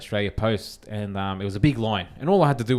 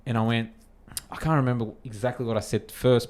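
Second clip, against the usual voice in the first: usual speaking voice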